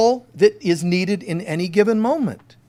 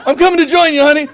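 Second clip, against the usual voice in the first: overjoyed fan; 11 semitones higher, 10 dB louder, much faster than usual. Retold or imitated. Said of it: "He was just so like".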